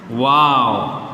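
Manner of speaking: वाव said alone, low and falling; demonstrated pronunciation. Shown as a demonstration. Teacher has pupils pronounce it, demonstrated pronunciation. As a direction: sound out वाव as one low, gliding word. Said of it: "pronounce करिए".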